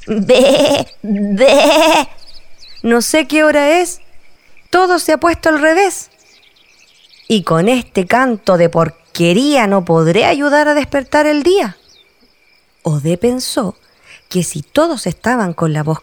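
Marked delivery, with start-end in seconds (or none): 0.1-2.1 loud goat bleat from a rooster; comic baa-baa. Acted. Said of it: "el gallo dijo". 2.8-11.8 high, louder, fretting rooster; a rooster who lost his crow, complaining; high worried rooster voice; upset rooster. Acted. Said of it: "el gallo dijo".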